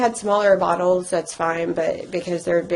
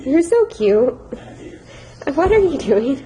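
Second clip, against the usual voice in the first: higher, louder cooing; talking to a puppy; 6 semitones higher, 3 dB louder than usual. Real, not acted. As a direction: coo up high at an adorable puppy.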